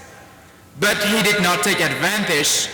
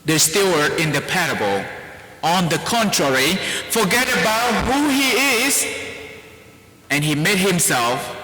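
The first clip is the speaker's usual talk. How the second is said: normal talking voice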